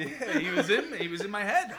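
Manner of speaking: high, loud, laughing admission. Real, not acted. Pitcher admitting the kid rattled him, laughing. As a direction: crack up while conceding it.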